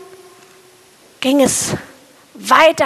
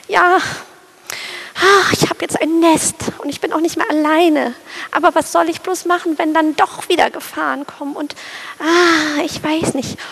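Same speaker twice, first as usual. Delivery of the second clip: higher, rushed worrying bird voice; anxious little sparrow; 4 semitones higher than usual. Acted. Fearful sparrow listing dangers to his new mate, high rushed fretting. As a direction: tumble out every fear fast.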